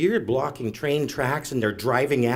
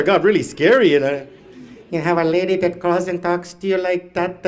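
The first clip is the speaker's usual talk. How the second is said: ordinary talk, nothing marked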